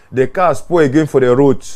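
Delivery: ordinary talk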